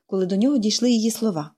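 ordinary delivery, nothing marked

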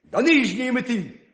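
furious hare shouts for another race; angry shout. Acted. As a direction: yell it in frustration. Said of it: "крикнув".

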